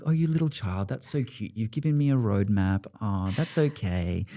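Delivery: put-on God gently humouring a child's plans; patronising God voice. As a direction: coo it like a doting parent to a toddler. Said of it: "I always do it like this".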